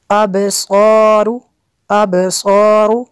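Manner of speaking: "अबसारु" with bouncing b, full s and r; qalqalah and heavy letters. Shown as a demonstration. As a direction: chant "अबसारु", echoing the b and thickening the s. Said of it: "बा पे कलकला होगा".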